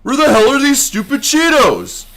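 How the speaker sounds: high, loud exasperated yell; frustrated shout. Acted. Frustrated search in the game.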